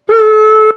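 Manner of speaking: loud, very high boop; imitated defibrillator noise. Imitating the shock in a movie scene, loud high noise. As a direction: mimic the machine with a piercing boop.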